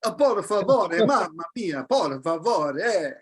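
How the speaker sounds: hint of mock Italian singsong. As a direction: lilt it like a jokey Italian. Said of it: "they speak like this".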